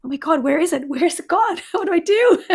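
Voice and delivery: normal talking voice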